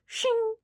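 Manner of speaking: high-pitched blade "shing"; quick ringing sound of a slasher grabbing a knife; imitated metallic shing; horror-movie knife grab. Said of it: "the slasher grabs it and goes".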